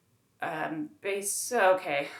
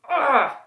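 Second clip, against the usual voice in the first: a loud, high groan of disgust; an exasperated loud ugh; loud frustrated ugh; 7 semitones higher, 10 dB louder than usual. Real, not acted.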